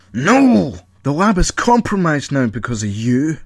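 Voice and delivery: loud, high angry blast; Paul yelling at Colin; furious shout. Acted. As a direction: snap back in rage. Paul enraged, scolding Colin.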